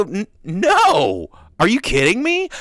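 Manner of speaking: loud, high incredulous no; hammed-up indignant refusal; mock outrage. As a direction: shut him down in disbelief. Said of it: "I'll look over and go".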